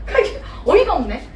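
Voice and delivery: ordinary talk, nothing marked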